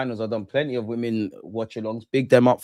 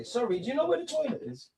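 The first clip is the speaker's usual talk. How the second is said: put-on higher-pitched British accent, held quiet; 11 semitones higher, 9 dB quieter than usual. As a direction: ask in a soft mock British accent, pretending to meet her. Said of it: "If you like the British accent".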